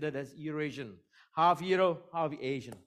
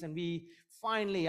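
ordinary talk, nothing marked

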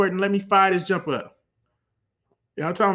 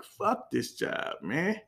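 quiet groan; 7 dB quieter, much slower than usual. Retold or imitated. Worker hating the job.

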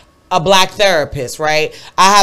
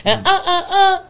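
put-on high, eager kid voice; eager students; 11 semitones higher than usual. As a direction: imitate eager kids. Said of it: "my kids automatically".

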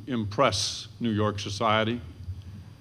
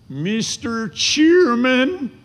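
much higher, louder and slow, a reedy frontier twang; Lincoln's high voice; 14 semitones higher, 7 dB louder, much slower than usual. Retold or imitated. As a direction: mimic Lincoln's shrill opening words to the chairman. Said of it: "in his accent".

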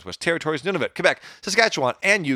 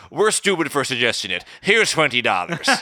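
louder, exaggerated Canadian accent drifting Irish; mock Canadian accent; 4 semitones higher, 5 dB louder than usual. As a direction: apologize in a broad put-on Canadian accent. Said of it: "You're sounding really Irish".

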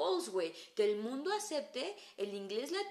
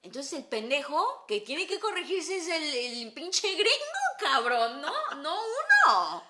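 louder, laughing, stretching "pinche gringo"; loud laughing rant; 4 semitones higher, 9 dB louder, slower than usual. Real, not acted. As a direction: drive the punchline home loud and laughing.

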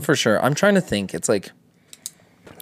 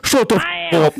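much higher hammed-up yell; mock angry shout. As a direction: snap at them like a hothead. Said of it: "And then Idris Elba's like".

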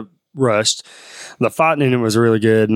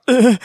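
loud pained grunt; 13 semitones higher, 5 dB louder than usual. Retold or imitated. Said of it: "Liu Kang's like".

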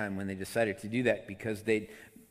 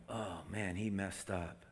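soft, lower murmur of onlookers; hushed disappointment; 3 semitones lower, 10 dB quieter, slower than usual. Acted. Crowd watching him walk off, hushed disappointment. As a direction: say it under your voice, let down.

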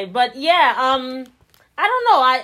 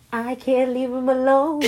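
higher, hammed-up lovesick lament; mock helpless plea; 4 semitones higher than usual. Woman joking about a toxic lover, mock helpless plea. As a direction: act hopelessly hooked, about to laugh.

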